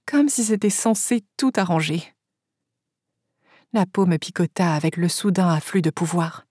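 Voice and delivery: plain reading voice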